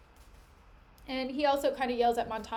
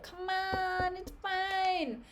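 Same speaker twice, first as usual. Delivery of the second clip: higher, coaxing tempter voice; coaxing Iago; 7 semitones higher, much slower than usual. Retold or imitated. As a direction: sweet-talk him into one drink.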